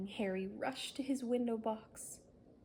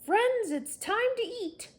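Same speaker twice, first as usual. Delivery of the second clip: calling out; 13 semitones higher, 9 dB louder than usual. Acted. Harry calls monsters to eat.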